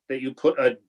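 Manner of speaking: normal talking voice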